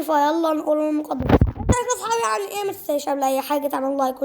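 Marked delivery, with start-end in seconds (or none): 1.2-2.7 high, fast youtuber hello; upbeat channel opener; cheery intro greeting. Acted. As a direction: bounce the greeting up high to the viewers.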